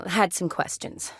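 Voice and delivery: normal talking voice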